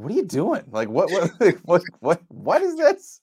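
stammering, high-pitched disbelief; mock bafflement. Co-host as coach's baffled wife and kids, mock bafflement.

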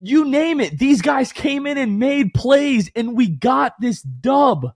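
roll-call the heroes at full shout; excited shouting. Real, not acted. Hyping the players after a win.

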